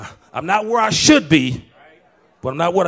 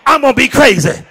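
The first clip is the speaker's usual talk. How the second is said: preaching shout; 7 semitones higher, 10 dB louder than usual. Real, not acted. Preaching about protecting his baby.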